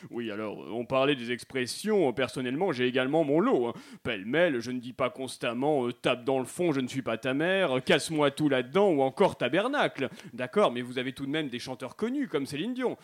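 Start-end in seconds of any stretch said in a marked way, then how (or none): 0.1-10.1 low-pitched Québécois; Québécois accent. Acted. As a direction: air a grievance in a Quebec accent, voice dropped. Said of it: "accent québécois aimerait réagir". (accent québécois, voix grave)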